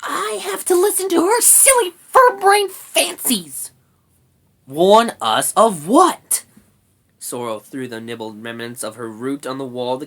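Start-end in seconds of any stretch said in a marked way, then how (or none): 0.0-3.7 very high, loud complaint; squeaky, fed-up rat; angry rat. A narrator's reading. Angry rat keeps ranting. 4.7-6.4 loud, higher, slow demand; grumpy brownie, drawn out; exasperated brownie. A narrator's reading. Brownie Sorrel snaps back.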